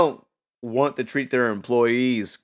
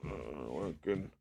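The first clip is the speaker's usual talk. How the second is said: half-awake; 3 semitones lower, 14 dB quieter than usual. Retold or imitated. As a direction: drift through the words barely conscious. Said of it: "semi-awake".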